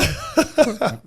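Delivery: high, drawn-out "Favela" as "flavella", laughing; laughing high-pitched mimicry of "Favela" as "flavella"; mocking mispronunciation. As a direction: tease with a squealed, stretched "Favela" said "flavella". Mocks the mispronunciation again, laughing.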